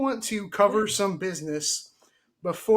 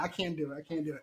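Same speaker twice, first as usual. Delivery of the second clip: normal talking voice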